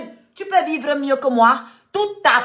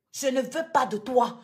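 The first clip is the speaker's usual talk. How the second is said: usual speaking voice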